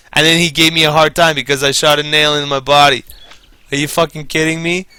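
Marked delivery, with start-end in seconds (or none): none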